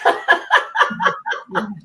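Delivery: high, long laugh; real high-pitched laughter. Real, not acted. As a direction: laugh heartily at your own story. Laughs at her own story.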